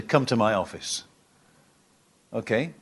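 a hint of a stern teacher calling a bad child in; mock stern headmaster. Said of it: "if I was headmaster and you were a bad child".

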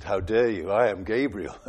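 low, affronted angel drawing himself up; indignant angel. Acted. Angel rebuking the doubting priest Zechariah, low and indignant. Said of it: "the angel drew himself up in height".

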